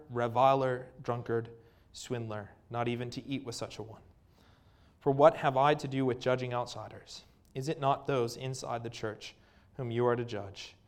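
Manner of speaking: ordinary talk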